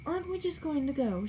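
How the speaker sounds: protest, put out; Daisy objects to Tom; objecting. A narrator's reading. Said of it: "she objected".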